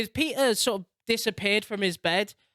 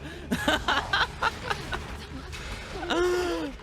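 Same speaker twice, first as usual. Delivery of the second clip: high, long disbelieving laugh; helpless laughter; 9 semitones higher, 3 dB quieter than usual. Real, not acted. Player laughing at Peter turning on MJ, high long laugh. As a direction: crack up at the scene.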